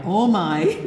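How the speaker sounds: breaking into laughter; laughing. Real, not acted. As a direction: laugh through oh my. Laughing at audience reaction.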